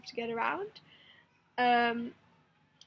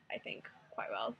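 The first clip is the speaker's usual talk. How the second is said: whisper; 11 semitones lower, 6 dB quieter than usual. Real, not acted. Trailing aside under the breath.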